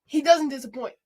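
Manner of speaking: loud, emphatic assurance; like meaning it. Shown as a demonstration. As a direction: say it loudly, like you mean it. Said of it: "Say it like you mean it".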